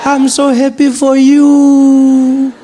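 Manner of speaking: put-on high, loud, slow delighted old lady; grandmother. Gogo congratulating Trevor on the phone, slow and high. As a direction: gush like a proud granny. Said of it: "And she's like".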